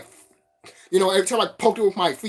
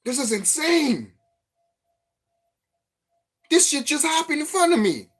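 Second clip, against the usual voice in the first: stunned disbelief; 10 semitones higher than usual. Retold or imitated. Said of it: "my lower self was looking at it like".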